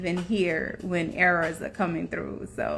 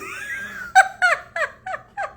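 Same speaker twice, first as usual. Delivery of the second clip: very high rising shriek of laughter; real high squeal. Real, not acted. Laughing at own quote.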